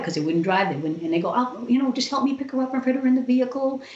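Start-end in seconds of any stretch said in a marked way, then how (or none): 1.3-3.8 higher, fast urgent plea to the nurses; husband urging nurses to lift her, higher and hurried; retold pleading. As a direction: push them to act, quickly.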